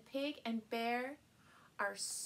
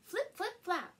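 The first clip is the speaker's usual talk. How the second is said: high, bright flip flip flap; hen flaps; 6 semitones higher, 5 dB louder than usual. A narrator's reading. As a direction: flutter the words like wings. Hen flapping sound effect.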